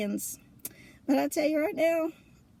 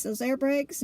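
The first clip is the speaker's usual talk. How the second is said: ordinary talk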